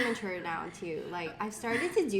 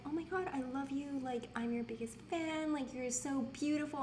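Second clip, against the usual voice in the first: higher, soft fawning fan; mock gushing; 6 semitones higher, 6 dB quieter than usual. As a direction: imitate a smitten admirer, light and high. Imitates guys gushing in DMs.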